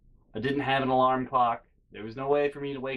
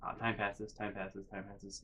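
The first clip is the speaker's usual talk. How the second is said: softer each time, dying out; fading to nothing; 5 semitones lower, 13 dB quieter than usual. Acted. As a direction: let it trail to silence. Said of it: "repeated phrase fading away".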